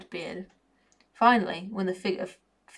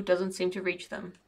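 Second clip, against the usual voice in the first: plain reading voice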